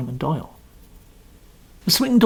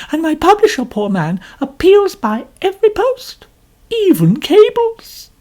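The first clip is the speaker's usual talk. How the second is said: much higher, louder complaining lady; complaining novelist; 10 semitones higher, 5 dB louder than usual. A narrator's reading. Mrs Otterbourne boasting to Poirot, high and complaining. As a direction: preen and grumble at once.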